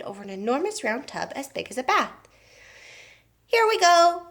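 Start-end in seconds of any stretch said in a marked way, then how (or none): 3.5-4.3 high, loud eager call; cried out. A narrator's reading. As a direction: announce it like a showman starting the machine. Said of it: "cried Mister Wonka".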